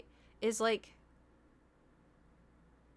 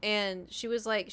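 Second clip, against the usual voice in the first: ordinary talk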